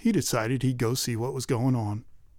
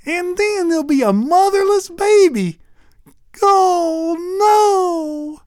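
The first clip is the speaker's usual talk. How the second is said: very high, louder crying wail; wailing sob; 6 dB louder, slower than usual. Acted. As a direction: break into a hammed-up cry. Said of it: "bawling her eyes out".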